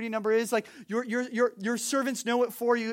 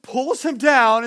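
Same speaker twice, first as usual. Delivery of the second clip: normal talking voice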